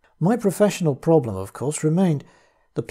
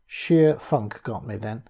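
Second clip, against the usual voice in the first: usual speaking voice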